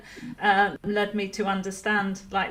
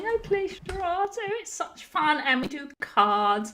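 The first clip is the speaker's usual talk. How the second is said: put-on posh English accent; 2 semitones higher than usual. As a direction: gush about fun like a grand lady. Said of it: "Posh Laura a question".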